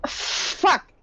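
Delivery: high, sudden yelped curse; alarmed outburst. Acted. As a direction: burst out with it in alarm. Player reacts to shadows being real.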